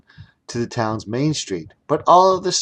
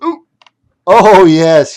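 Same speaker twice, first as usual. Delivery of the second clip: delighted exclamation; 7 semitones higher, 12 dB louder, slower than usual. Acted. Wizard delighted.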